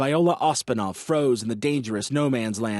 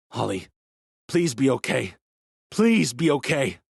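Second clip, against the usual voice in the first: distraught; 3 dB louder than usual. A narrator's reading. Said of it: "suddenly distraught".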